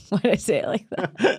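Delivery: usual speaking voice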